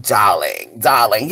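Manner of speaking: very low, mannish diva drawl; mock a diva's voice gone deep with age. Mocking the older singer's ruined voice, deep and low. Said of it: "Now she sounding like this".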